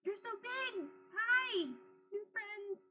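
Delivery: put-on high, bubbly childlike squeal; excited little creature voice. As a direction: gush like an excited tiny creature. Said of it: "and go".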